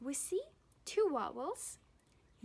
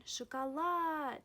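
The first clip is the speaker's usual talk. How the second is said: "шоколад" with the final A held long and raised; emphatic shakaLAAD for "шоколад"; stress on final A; 4 semitones higher, 5 dB louder than usual. Shown as a demonstration. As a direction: swell on "лад" in "шоколад". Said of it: "falls on A".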